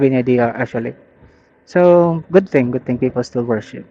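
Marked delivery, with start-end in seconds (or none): none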